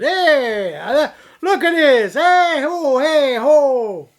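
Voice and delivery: loud laughing Sopranos-style catcall; loud, high mock Jersey Italian shout with laughter; mock Italian-American accent. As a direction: holler like a hammed-up wise guy. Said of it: "sounded like the guys from, uh, The Sopranos".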